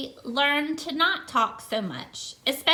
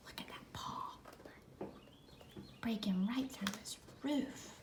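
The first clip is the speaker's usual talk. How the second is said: whisper; 5 semitones lower, 19 dB quieter than usual. A narrator's reading. Hiding, whispering about the paw.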